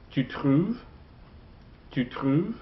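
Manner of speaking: "trouves" swept upward as a question; rising intonation. Shown as a demonstration. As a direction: let "trouves" climb at the end, as if asking. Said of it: "Intonation rising".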